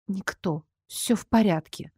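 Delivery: hoarse croak. A narrator's reading. Injured girl brushes off the worried boy, croaking softly. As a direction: force the words out weakly. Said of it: "прохрипела".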